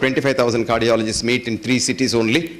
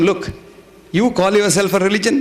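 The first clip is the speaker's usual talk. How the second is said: higher-pitched, incredulous challenge; indignant rebuke; 7 semitones higher than usual. Retold or imitated. Telling the secretary off over religious hatred, indignant rebuke. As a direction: confront him with his contradiction.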